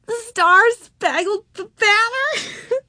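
very high, slow, choked with laughing; gasping, laugh-broken squeal; helpless laughter. A narrator's reading. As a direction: squeeze the words out between laughs. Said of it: "Her laughter dwindled to giggles".

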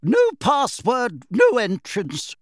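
high, slow haughty portrait lady; lofty. A narrator's reading. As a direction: look down your nose at him. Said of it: "she said loftily".

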